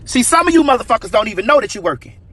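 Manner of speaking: usual speaking voice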